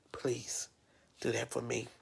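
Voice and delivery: soft, breathy whispered please; hushed heartfelt request. Real, not acted. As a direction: beg them quietly, almost in a whisper. Pleading for the babies' safety.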